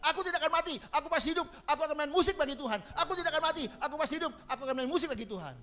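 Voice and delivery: high fervent shout; shouting the verse. Retold or imitated. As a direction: shout out faith from the sickbed. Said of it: "dia berteriak".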